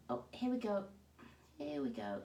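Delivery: normal talking voice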